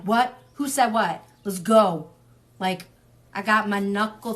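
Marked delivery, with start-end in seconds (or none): 0.0-2.1 louder, ready-to-fight challenge; squaring up, hammed-up; mock brawl call. Sag friend ready to brawl, mock tough shout. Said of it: "we're like".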